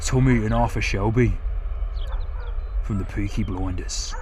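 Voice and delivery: a playful Peaky Blinders Brummy; Brummy accent. Pretend. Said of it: "What do you guys reckon to my Brummy".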